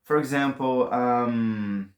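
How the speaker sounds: ordinary talk, nothing marked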